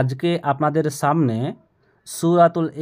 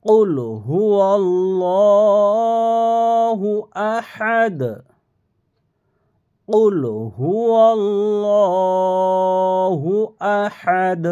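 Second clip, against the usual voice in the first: slow chanted recitation, higher and louder; Quran recitation; 6 semitones higher, 4 dB louder, much slower than usual. Shown as a demonstration. Teacher recites for students to copy, slow chant. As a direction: recite slowly with long vowels. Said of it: "বলছি দেখেন".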